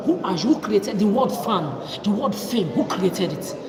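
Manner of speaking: loud, angry demanding questions; furious shout. Real, not acted. Ranting at men who named her a fan, furious shout. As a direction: shout the challenge at the world.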